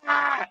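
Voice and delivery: high, loud mock cry of frustration; exasperated cry. Client fed up with trainer's answer, exasperated cry.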